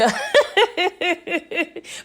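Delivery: a high gleeful cackle; gloating laugh about Monet getting told off; high delighted laugh. Real, not acted. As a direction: laugh with real glee.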